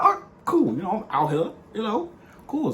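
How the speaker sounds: high-pitched mock-casual reply; imitating yourself playing it cool. Acts out his casual reply to her text.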